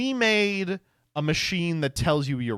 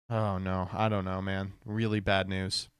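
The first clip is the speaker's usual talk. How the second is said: low, soft, deflated mutter; lightly hammed-up dismay; mock gloom; 7 semitones lower, 5 dB quieter than usual. Real, not acted. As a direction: sink into a mock-despairing tone. Reacts gloomily to bad news.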